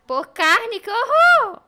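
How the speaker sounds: slow read rising to a high, loud cry; horrified. Real, not acted. Streamer finds option to trade cow for meat, horrified. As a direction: build into an appalled outcry.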